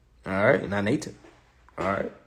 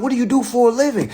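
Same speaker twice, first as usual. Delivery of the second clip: high squeaky lender; 13 semitones higher, 8 dB louder, faster than usual. Retold or imitated. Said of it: "They be like".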